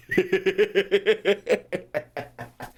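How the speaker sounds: a short laugh. Real, not acted.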